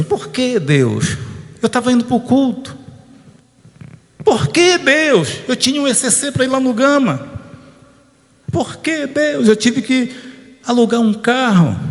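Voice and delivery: high-pitched, plaintive questioning of God; mock complaint. Preacher imagining himself questioning God over the car crash, mock complaining. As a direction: act out a protest to heaven, pitched well up.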